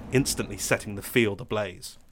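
plain reading voice

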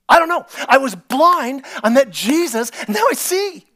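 high, loud, exuberant; excited testimony. Acted. Healed blind man answering questions, loud and high. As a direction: blurt it out gleefully.

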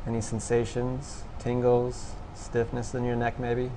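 soft, low-key meditation guidance with long pauses; guided meditation. Real, not acted. Instructor guiding listeners to notice thoughts and sensations, quiet meditation voice. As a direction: gently prompt them, leaving long silences. Leads a meditation after breathing exercise.

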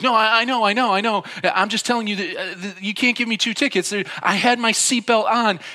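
high, loud, stammering panic; struck with fear. Retold or imitated. Driver begging officer not to ticket him twice, frantic. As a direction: imitate a driver frantically arguing his case. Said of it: "he is just so struck with fear".

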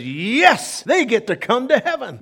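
joyful. Acted. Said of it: "he says".

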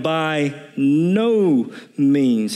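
"no" stretched out very slow; stress on NO. Shown as a demonstration. Preacher repeating Paul's answer to the congregation, very slow. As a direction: drag out "By no means" with weight on "no". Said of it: "he says".

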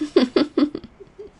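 bright burst of laughter. Real, not acted. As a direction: laugh out loud at the joke. Laughs at grand entrance joke.

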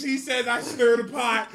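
usual speaking voice

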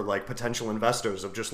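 usual speaking voice

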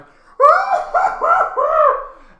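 high, loud crowd whoop; mock crowd noise. The whole crowd reacts to Hulk, loud high whooping. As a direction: imitate a whole audience going wild.

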